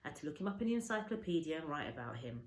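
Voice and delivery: ordinary delivery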